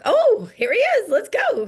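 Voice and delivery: gleeful welcome; excited. Retold or imitated. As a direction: hype up his arrival. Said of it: "I'm like".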